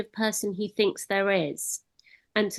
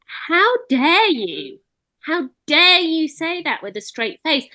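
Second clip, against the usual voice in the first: slow, high-pitched retelling of indignation; indignant outrage; 8 semitones higher, 4 dB louder than usual. Retold or imitated. Recalling her reaction to a politician's claim, indignant. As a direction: draw out an affronted challenge, higher in pitch. Indignant retort to Maggie Chapman retold.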